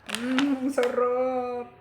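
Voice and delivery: loud, delighted mmm of pleasure; delighted savoring. Real, not acted. Savoring ice cream on camera.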